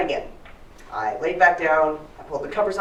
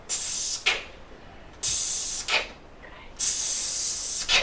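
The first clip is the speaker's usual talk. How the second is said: long sharp hiss cut off with a click; monster's hiss; 7 dB quieter, much slower than usual. Acted. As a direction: hiss it out, then click shut. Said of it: "He makes a terrible hiss".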